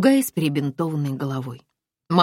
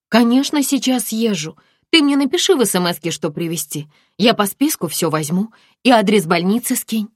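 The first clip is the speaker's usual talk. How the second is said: put-on higher, louder brisk girlfriend; eager friend; 7 semitones higher, 7 dB louder than usual. Friend promises to fetch her things, eager. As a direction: rattle off the plan.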